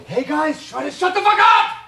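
furious shout to shut them up; angry shout. Real, not acted. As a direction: bark it at people talking next door. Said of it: "I'm getting mad".

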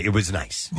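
ordinary talk

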